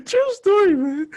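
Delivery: very high, loud laughing exclamation; real squealed laughter in the words. Real, not acted. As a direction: insist on it while cracking up. Laughing after punchline.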